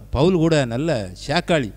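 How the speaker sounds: ordinary delivery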